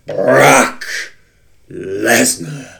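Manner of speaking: slow, stretched-out introduction; wrestling announcer. Acted. Host mimicking a manager's introduction, drawn-out announcer delivery. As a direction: draw out each name with a pause.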